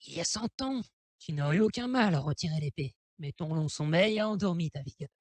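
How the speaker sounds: solemn ancient tree, lightly put on; Deku Tree voice. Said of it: "est-ce qu'on pourrait lui faire une voix?".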